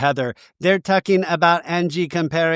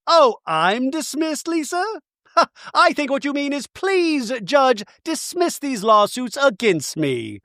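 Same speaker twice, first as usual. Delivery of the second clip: high-pitched put-on retort; mock sarcasm; 9 semitones higher than usual. Imitating Bronwyn answering Lisa in interview, mock sarcasm.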